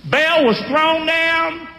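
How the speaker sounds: high, slow preaching chant for the church to echo; slow call-out. Shown as a demonstration. Preacher leading the church, slow high call-and-response chant. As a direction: lay each phrase out slowly so they can repeat. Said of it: "Say that with me".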